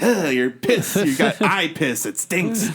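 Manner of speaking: a hint of a disgusted cat voice; mock feline disgust, lightly voiced. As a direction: play a mildly grossed-out talking cat. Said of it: "Spot is like".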